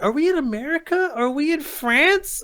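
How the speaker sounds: high-pitched incredulous questioning; exasperated bafflement. Real, not acted. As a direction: demand to know, utterly lost. Mock-baffled rant about the setting.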